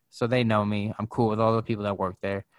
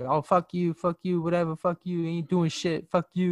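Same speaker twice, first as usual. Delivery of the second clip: higher-pitched dismissive cursing; mock taunting; 6 semitones higher than usual. Customer punking the security guard, mock taunting. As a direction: imitate her mouthing off, pitched up. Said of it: "she keeps punking him".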